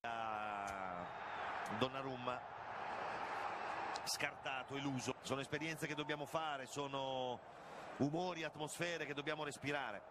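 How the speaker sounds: usual speaking voice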